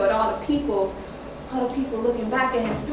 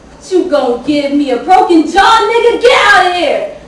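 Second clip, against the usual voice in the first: shouted at full volume, pitch leaping up; angry shout; 6 semitones higher, 12 dB louder than usual. Acted. Mother yelling the father away from her, furious shout. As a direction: yell him off, outraged.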